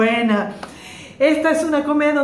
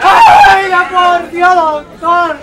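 hysterical wailing wife; 3 semitones higher, 10 dB louder, much slower than usual. Acted. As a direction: howl it like a soap opera widow.

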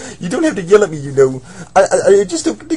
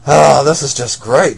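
normal talking voice

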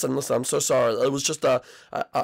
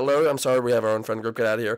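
usual speaking voice

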